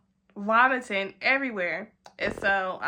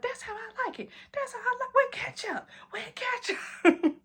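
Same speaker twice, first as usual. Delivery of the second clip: put-on happy drunk squeal; 12 semitones higher, 3 dB quieter than usual. Savouring the hash brown, high tipsy coo fading to a whisper. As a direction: coo then breathe out with ketchup.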